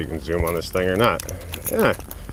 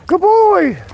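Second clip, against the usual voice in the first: high praise; 9 dB louder than usual. Real, not acted. Owner praising dog after weaving cones, high excited cheer. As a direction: cheer him on like a puppy.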